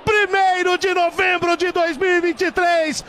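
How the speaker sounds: shouted, triumphant football call; goal celebration commentary. Acted.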